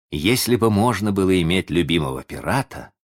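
usual speaking voice

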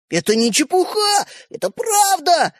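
high, slow pleading yell. A narrator's reading. Brothers wailing to convince their sister. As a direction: wail it out insistently. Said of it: "завопили братья".

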